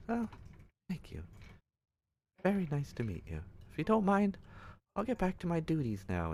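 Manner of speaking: courteous, eager to leave; polite lady voice. Acted.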